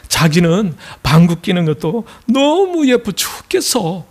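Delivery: hammed-up doting lover, stretching the key word; gushing boyfriend. Seoul man praising his girlfriend's fart, gushing. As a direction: gush with mock adoration. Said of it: "하는 말이 또 가관입니다".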